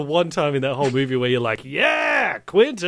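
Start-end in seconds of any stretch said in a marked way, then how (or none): 1.6-2.9 high-pitched whooping fan shout; excited cheer. Acted. Viewer cheering Tarantino's one great moment, excited high shout.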